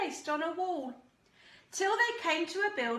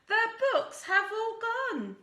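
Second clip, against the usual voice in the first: upset cry; 9 semitones higher than usual. Acted. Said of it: "cried out Peter".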